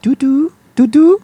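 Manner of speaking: high-pitched mock French lilt; lightly French, high and rising on each word. Showing friends how the dog's name sounds, mock French voice. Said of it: "say it in a French voice".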